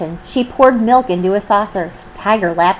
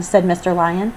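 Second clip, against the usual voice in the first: plain reading voice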